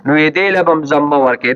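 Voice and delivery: usual speaking voice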